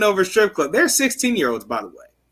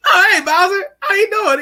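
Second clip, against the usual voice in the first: much higher, loud, laughing hello; cheery greeting; 5 dB louder than usual. Retold or imitated. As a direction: greet him way too cheerfully. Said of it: "So he goes".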